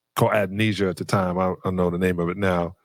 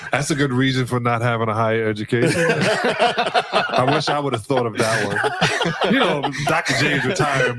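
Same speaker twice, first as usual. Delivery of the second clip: laughing; 3 semitones higher than usual. Real, not acted. Amused at Kobe's answer.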